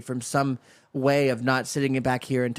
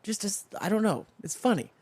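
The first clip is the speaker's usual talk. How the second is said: ordinary talk, nothing marked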